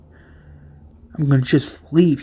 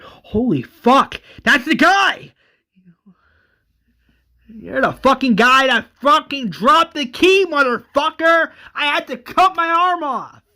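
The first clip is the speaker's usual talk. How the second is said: furious yelling; 9 semitones higher than usual. Acted. Merle raging at T-Dog.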